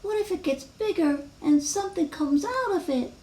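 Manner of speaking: high little worrier's voice; acted childlike worry; anxious worrying. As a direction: fret in a small, high character voice. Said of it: "Wemberly worried".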